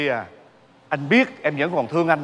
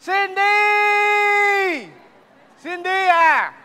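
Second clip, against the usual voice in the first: long high shout across the yard; calling out; 13 semitones higher, 4 dB louder, much slower than usual. Acted. Husband calling out for his wife, a long high shout. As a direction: yell the name to call her back.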